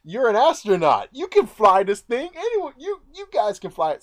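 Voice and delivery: excited realization. Retold or imitated. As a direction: sell it like you've found the answer. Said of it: "He was like".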